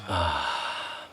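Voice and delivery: long sigh sinking in pitch; audible sigh. Shown as a demonstration. Teacher sighing out with the group on the second breath, audible sigh. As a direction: exhale on a drawn-out, relaxed "ah". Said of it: "making some noise".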